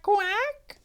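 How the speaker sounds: high, put-on duck quack.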